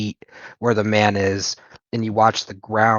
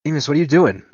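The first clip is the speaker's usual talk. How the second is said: raised, louder pleading question, stress on "doing"; alarmed cry; 7 semitones higher, 7 dB louder, much faster than usual. Acted. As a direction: call out in alarm to a bleeding friend. Nico alarmed, friend is bleeding.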